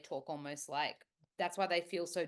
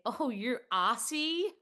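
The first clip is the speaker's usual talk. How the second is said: mock American saying Aussie, higher and louder; drawn-out American accent, pitched up; 6 semitones higher, 5 dB louder, slower than usual. American greeting an Australian, slow high American accent. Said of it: "an American would say".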